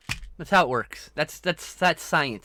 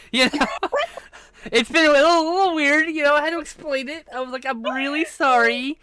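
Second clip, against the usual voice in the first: high, loud, laughing through the words; giggling embarrassed confession; 9 semitones higher, 5 dB louder than usual. Real, not acted. Streamer tells friend an embarrassing story, laughing.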